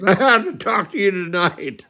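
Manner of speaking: high mock-tearful plea; put-on weepy voice; imitated bawling. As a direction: plead like a bawling drunk. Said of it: "And he's bawling".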